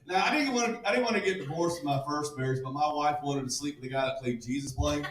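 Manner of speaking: normal talking voice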